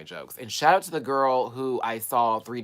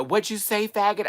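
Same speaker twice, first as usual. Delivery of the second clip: higher, mock confrontational yell; retold yell; 9 semitones higher, 4 dB louder than usual. Rude driver yelling out the window, imitated. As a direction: call it out like an angry driver. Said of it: "he just gonna yell out the window".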